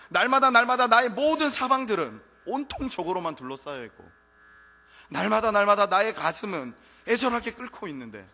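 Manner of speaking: loud, high, impassioned preaching; preaching at full force. Acted. Preacher crying out to the congregation, full force. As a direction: cry it out to the congregation.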